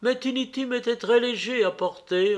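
lightly acted wistful mother; tender mother. A narrator's reading. Mother resumes, remembering her child.

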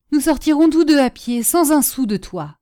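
high, loud start, coming down in pitch; despairing rage. A narrator's reading. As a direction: fling his money back in furious refusal. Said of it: "avec la rage du désespoir". (rage désespérée, voix forte)

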